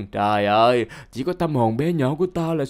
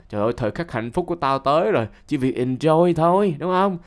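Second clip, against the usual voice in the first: ordinary talk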